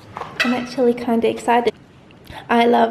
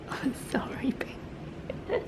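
hushed apologetic whisper; 3 semitones higher, 13 dB quieter, much slower than usual. Real, not acted. Whispering sorry to her baby girl the pet. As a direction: breathe a soft sorry.

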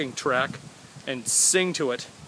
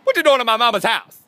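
loud, high bellow at the intruder; outdoor voice; 9 semitones higher, 9 dB louder than usual. Pretend. Yelling at an intruder in mama's house, loud and high. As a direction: yell it out big and high. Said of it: "Why does it sound like that?".

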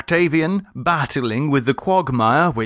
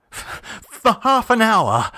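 high, slow anxious offer; 11 semitones higher, slower than usual. A narrator's reading. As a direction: haggle nervously with the children. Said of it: "anxious ring in his voice".

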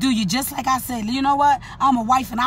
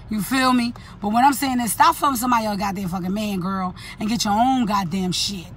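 ordinary talk

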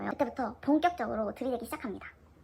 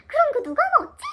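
much higher, loud mock wonder; feigned surprise; 12 dB louder than usual. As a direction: hide the joy behind surprise. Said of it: "기쁨을 놀람으로 숨기면서". (기쁨을 숨긴 과장된 놀람)

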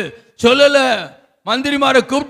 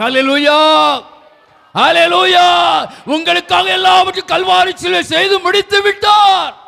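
triumphant shout; 6 semitones higher than usual. Real, not acted. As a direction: shout the victory to the whole congregation. Climax of preaching point.

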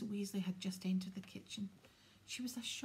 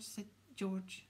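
plain reading voice

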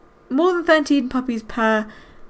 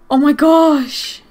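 excited gasp; 3 semitones higher, 6 dB louder, much slower than usual. Real, not acted. Gasp at bees attacking.

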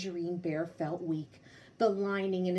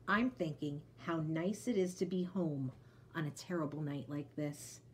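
content, soft; 4 semitones lower, 4 dB quieter than usual. Acted. Bear content at home.

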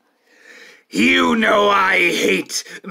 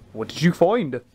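normal talking voice

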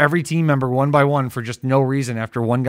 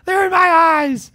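high, loud, slow hammed-up wail; hammed-up Wicker Man wail; Nicolas Cage panic; 5 dB louder than usual. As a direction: wail it in mock horror like Cage. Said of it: "Nicolas Cage from Wicker Man".

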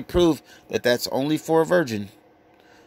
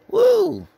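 sudden high excited whoop; 5 dB louder than usual. Real, not acted. Reacting to the price jump with a high whoop.

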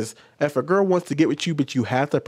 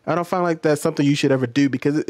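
ordinary delivery

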